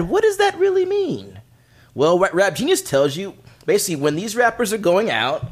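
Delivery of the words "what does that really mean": Anglo dad voice. Retold or imitated. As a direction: play the confused dad, pitched up. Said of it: "as the Anglo dad".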